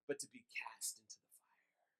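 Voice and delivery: soft, breathless whispered threat; ominous whisper. Acted. Pastor ending the Edwards quote to the congregation, menacing whisper. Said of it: "this is what Jonathan Edwards says in this sermon".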